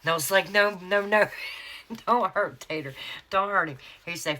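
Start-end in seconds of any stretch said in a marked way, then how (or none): 0.5-3.8 high, loud retold plea; imitating a panicked plea, higher and louder; mock pleading. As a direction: playfully beg in mock panic. Retelling her plea to spare Tater.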